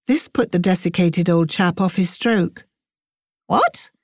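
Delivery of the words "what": high, shocked squeak of surprise; old professor startled by the unexpected praise. A narrator's reading. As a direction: jolt up into a squeak, caught off guard. Sneagle thrown by compliment.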